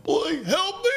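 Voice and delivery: very high, squealed plea for help; high comic cry for rescue; voice of the car. Pretend. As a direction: give the poor car a squeaky, desperate voice. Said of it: "If that car had, like, a voice".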